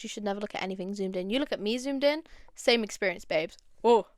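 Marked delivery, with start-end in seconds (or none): none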